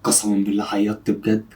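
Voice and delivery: low, softened confidential voice; hushed, low admission; low aside. Real, not acted. Secret aside about friend crying.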